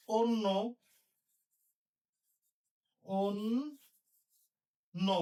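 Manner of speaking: অন্য as on-no, then stretched into on... no; closed o demo. Shown as a demonstration. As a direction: repeat অন্য slowly, split after a round on. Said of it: "এটা হবে".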